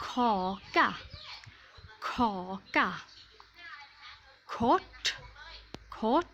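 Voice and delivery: ขอ กะ and คด with a hard aspirated k at the start; hard k sound. Shown as a demonstration. Teacher giving Swedish examples, ขอ กะ and คด with hard k. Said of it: "ออกเสียงคอควาย ก็อย่างเช่นคำว่า".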